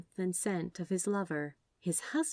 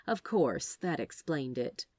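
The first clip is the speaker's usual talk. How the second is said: usual speaking voice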